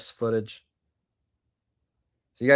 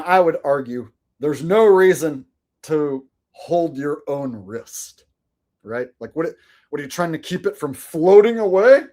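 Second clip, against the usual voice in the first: ordinary delivery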